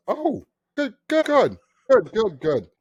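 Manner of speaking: very high, repetitive mock approval; older man's reaction. Older guy told they abolished slavery, high mock approval. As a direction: rattle it off in a high put-on voice. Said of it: "as he's saying good, he's shaking his head".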